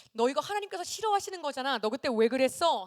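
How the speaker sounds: loud, quick reproach; acted scolding. Adult asking a child why they disobeyed God, scolding. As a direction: press the wrongdoer for an explanation.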